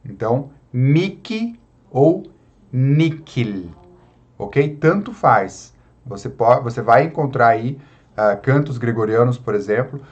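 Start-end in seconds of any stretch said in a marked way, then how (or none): 0.7-3.7 "Miki ou Nikil" with a hard K in the middle. Shown as a demonstration. Teacher showing the alternative Latin reading, H said as K. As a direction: pronounce "Miki" and "Nikil" with crisp K sounds. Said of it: "pronunciar como um K".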